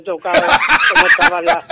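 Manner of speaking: laughing retelling. Retold or imitated. Singer retelling her pianist's answer to the host, laughing. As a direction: quote the retort through your laughter.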